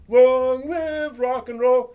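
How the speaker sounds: high, loud, slow proclamation; slow, drawn-out mock rally cry; mock rock cheer. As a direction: belt it out like a rock anthem.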